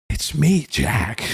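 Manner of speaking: Nicholson drawl, sink on the name; Jack Nicholson impression. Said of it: "that's how he talks".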